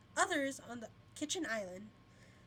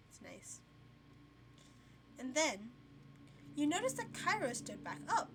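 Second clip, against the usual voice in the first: usual speaking voice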